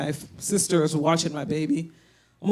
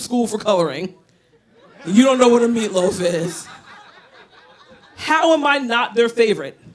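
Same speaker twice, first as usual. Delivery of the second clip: loud, higher indignant rant; 5 semitones higher, 5 dB louder than usual. Real, not acted. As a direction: rant about being unloved. Ranting at sister, parents.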